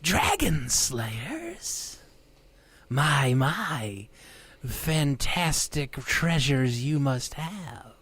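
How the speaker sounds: slow, drawn-out creature voice; greedy hidden creature musing; unearthly voice. Acted. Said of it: "then hear the voice say".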